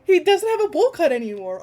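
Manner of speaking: high and loud, laughing through the words; laughing excitedly. Real, not acted. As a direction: laugh while you squeal it. Reader delighted by the reveal.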